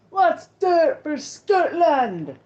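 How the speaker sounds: slow, stretched battle cry, pitched high and loud; rallying cry. Retold or imitated. Retold bully yelling a Scottish battle cry. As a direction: rouse the whole room for Scotland.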